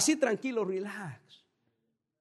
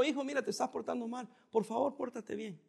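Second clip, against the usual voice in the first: gentle father; 8 dB quieter than usual. Retold or imitated. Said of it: "un papá le dice a un hijo".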